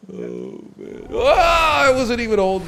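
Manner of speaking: wheezing out of a laugh, then a high loud yelp; laughing fit. Real, not acted. Host still laughing at his own airplane story, breathless laughter. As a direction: gasp it out between laughs.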